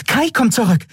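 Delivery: very high-pitched little voice; Nelen. A narrator's reading. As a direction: announce it in a tiny, high voice. Fairy figure announcing.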